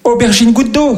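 high, hesitant mock stammer; boy stuck on the phone, high and lost; nervous boy voice. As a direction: stumble it out, way up high. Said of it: "tu peux pas faire".

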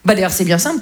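ordinary delivery

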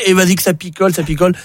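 usual speaking voice

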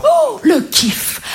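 high, drawn-out swoon of delight; thrilled. Acted. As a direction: savour it slowly in a high, delighted voice. Child thrilled by first record.

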